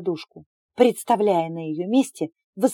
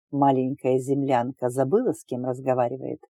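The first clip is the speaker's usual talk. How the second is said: smug man voice; 6 semitones lower than usual. Acted. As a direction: play the smug alien suitor. The suitor condescends to her.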